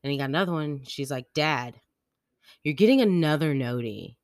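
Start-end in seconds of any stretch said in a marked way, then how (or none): 1.3-4.2 louder, mildly mock-annoyed complaint; imitating pissed kid. Daughter interrupted by another text, mock-annoyed. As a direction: break after the name, then lean on the complaint. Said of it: "Like, she got pissed".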